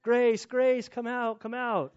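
frantic parents calling their lost daughter; panicked parent. Retold or imitated. Father reliving searching the house in a panic. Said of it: "running around the house kinda panicking".

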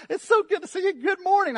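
cheery morning person. Retold or imitated. Morning person greeting wife.

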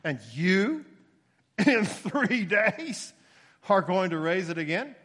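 mock the claim by stretching "in three days" way up; stress on you and three days. Shown as a demonstration. Preacher acting the leaders' scornful question, stressing "you" and "three days". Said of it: "emphasized in the way that's written in the Greek".

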